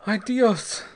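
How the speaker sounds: high, anguished outcry; unsteady. A narrator's reading. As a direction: cry out as if losing your grip. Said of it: "said Ortiz unsteadily".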